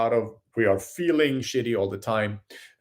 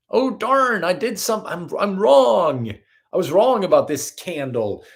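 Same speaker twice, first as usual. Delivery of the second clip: high, loud flustered admission of being wrong; dismayed realization; 7 semitones higher, 5 dB louder than usual. Retold or imitated. Arguing about a candle, suddenly sees he was wrong, flustered. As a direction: blurt it out, caught off guard.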